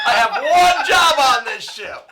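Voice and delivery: loud laughing protest; laughing shout. Real, not acted.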